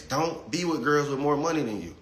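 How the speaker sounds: usual speaking voice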